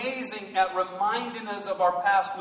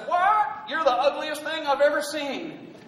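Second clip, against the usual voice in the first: high, squawked "what", then a high-pitched insult; talking parrot; 6 semitones higher than usual. Acted. Parrot insults a pet store customer, high rude parrot voice. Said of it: "and the parrot says".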